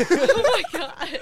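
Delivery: loud slow laughing exclamation. Real, not acted. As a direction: shout it while cracking up. Laughing at friend's singing.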